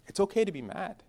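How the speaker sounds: calm him down while you set the rules; gentle talk to son. Retold or imitated. Father sitting his angry son down, soft and patient. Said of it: "we go".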